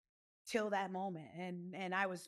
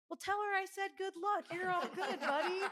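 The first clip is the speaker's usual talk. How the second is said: high, laughing friendly cop; mock cop, much higher and louder, through a laugh; cheerful cop; 11 semitones higher, 4 dB louder than usual. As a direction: play the cop letting her off with a laugh. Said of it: "He goes".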